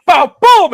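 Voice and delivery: loud, high "bow, boom"; imitated blows. Retelling a karate knockout to his co-host, explosive mock impacts. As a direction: punch out the hit noises.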